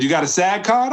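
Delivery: high, animated imitated question; retold eager question. Director asking a newcomer about his card, animated question. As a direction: pitch it up, rising at the end.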